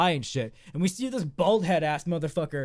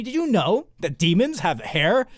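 ordinary delivery

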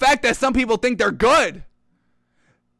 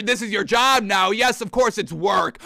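normal talking voice

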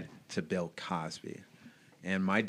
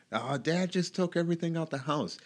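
put-on higher-pitched voice of a child reporting; mock-urgent tattling on the phone, pitched up; imitating alarmed brother; 9 semitones higher than usual. Older brother calling mom about dad, in a high imitated voice.

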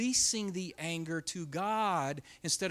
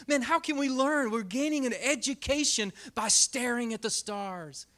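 usual speaking voice

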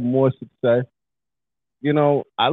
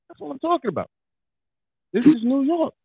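higher-pitched, fired-up hometown pride; hyped New Yorker; 9 semitones higher than usual. Retold or imitated. Listener feeling New York music in the car, hyped. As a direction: act out getting hyped on a track.

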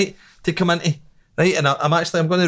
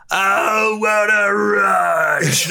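wrestling entrance yell; 4 semitones higher, much slower than usual. Retold or imitated. Imitating wrestling entrance music.

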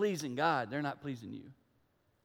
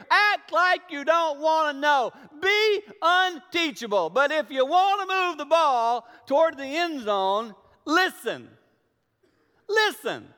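much louder and higher, slow punched commands; preaching at full force; 9 semitones higher, 7 dB louder, slower than usual. Acted. Pastor urging the congregation to listen, full preaching voice. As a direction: drive it home like a preacher's climax.

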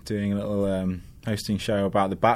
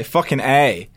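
attempted American accent; 3 semitones higher, 4 dB louder, slower than usual. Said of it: "what do you guys say?".

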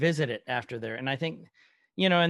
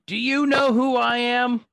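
loud, drawn-out, higher-pitched outraged yell; retold yell; 8 semitones higher, 7 dB louder than usual. Host retelling new Cap pinning a man to the wall, yelling. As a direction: belt it out slowly as an indignant demand. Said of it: "he yells at the dude".

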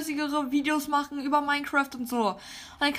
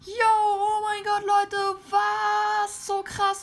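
excited; 5 semitones higher, slower than usual. Pretend. Unboxing million play button in game.

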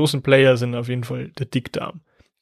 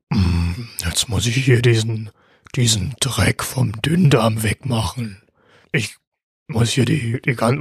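clichéd fat guy with a deep voice; deep large intestine voice; 3 semitones lower than usual. Acted. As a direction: grumble like a big lumbering gut. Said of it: "der hat eine ganz tiefe Stimme".

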